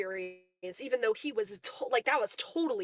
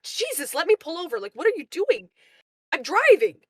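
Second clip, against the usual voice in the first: retold shout of alarm at a passenger opening the car door, higher and louder; raised, panicky voice telling him to stop; alarmed yell; 7 semitones higher, 6 dB louder than usual. Driver yelling at passenger mid-drive, alarmed shout.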